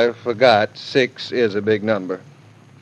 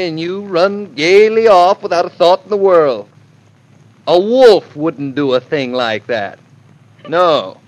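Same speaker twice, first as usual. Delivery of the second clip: loud, raised, blustering reproach; indignant scolding; 4 semitones higher, 4 dB louder than usual. Acted. Son-in-law berating his mother-in-law on the phone, indignant. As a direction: scold her with pompous outrage.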